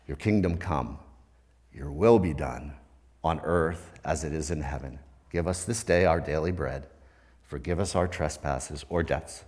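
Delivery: low, soft, reverent recital of the prayer; hushed reverent prayer; prayer recitation. Acted.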